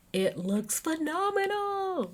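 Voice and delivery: high, drawn-out rave; gushing. Real, not acted. Host raving about Jersey's new season, gushing. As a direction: stretch it out high in delight.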